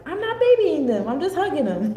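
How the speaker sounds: high, loud protective mom voice; doting mother voice. Pretend. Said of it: "And the moon was like".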